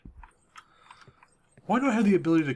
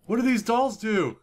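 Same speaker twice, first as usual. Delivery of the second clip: alarmed by the dolls; scared cries; 5 semitones higher than usual. Real, not acted. Creepy dolls scare her.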